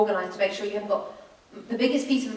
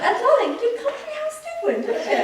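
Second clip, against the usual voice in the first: very high, fluting motherly voice; hammed-up high mum voice; imitated English mum. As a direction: put on mum's high-pitched innocent query. Voices her mum sweetly asking.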